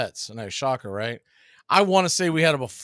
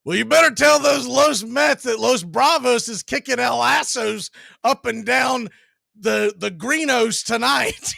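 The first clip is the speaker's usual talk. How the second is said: much higher, louder hammed-up yell; bellowing; 9 semitones higher, 6 dB louder than usual. Taunting the Mets fan to the whole section, bellowed. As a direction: bellow it across the stands, slowing at the end. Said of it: "I bellow out".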